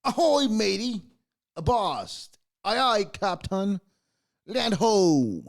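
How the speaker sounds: lightly hammed pirate calls; mock pirate phrases. Host reading sample pirate phrases in a light pirate lilt. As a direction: toss out pirate greetings playfully. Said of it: "people are saying".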